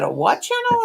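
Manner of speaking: high, baffled "A what channel?"; puzzled high question. Retold or imitated. Baffled viewer asking a crochet streamer what channel. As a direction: ask it like you misheard.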